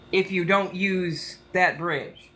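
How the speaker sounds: usual speaking voice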